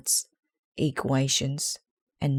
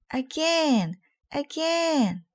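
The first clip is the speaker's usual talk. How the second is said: put-on high, eager kids' cheer; kids shouting; 12 semitones higher, slower than usual. A narrator's reading. Kids watching Arthur fall demand more, high eager cheer. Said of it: "they shouted".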